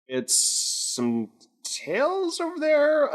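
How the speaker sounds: high, slow, disbelieving; astonished drawn-out high voice; incredulous. Acted. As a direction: stare and stretch it out in disbelief. Graves reacting in character.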